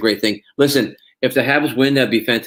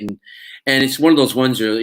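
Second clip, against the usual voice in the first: normal talking voice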